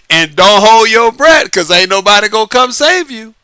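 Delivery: ordinary delivery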